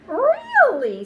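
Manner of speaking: very high, loud squeal of disbelief; a dog who cannot believe it; startled dog asking the frog; astonished dog. Acted. Dog surprised at new rule.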